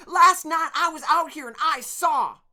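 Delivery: put-on very high, loud boy's cry; shrill and urgent, arms waving. Torin crying out to the workers, shrill. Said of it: "his shrill, high voice".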